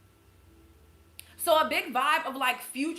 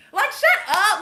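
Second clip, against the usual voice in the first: higher and louder, fed-up shout; exasperated shout; 8 semitones higher, 9 dB louder, slower than usual. Real, not acted. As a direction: snap it loud and fed up. Pet peeve about gender wars.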